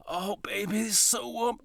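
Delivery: the sexy version he pictured, soft and intimate; imagined sultry line. Pretend. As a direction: breathe it like a romantic lead. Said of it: "in my head, I was sort of, like, picturing it".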